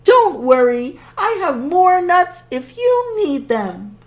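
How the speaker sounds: put-on high, lively old woman voice; grandmother voice. Baba Brena voice.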